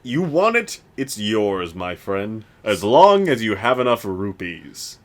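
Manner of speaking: lightly voiced high shopkeeper; a hint of the Zelda merchant; Morshu impression. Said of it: "Morshu is a microwave".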